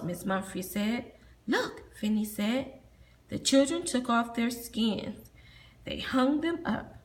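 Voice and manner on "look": high, eager call; excited look. Acted. Finny pointing out the children's skins, excited.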